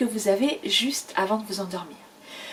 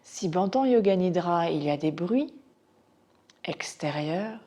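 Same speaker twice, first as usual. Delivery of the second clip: unhurried body-scan guidance with long pauses; guided meditation. Acted. Teacher guiding students' attention to floor and sounds, meditation voice. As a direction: guide their attention gently from point to point.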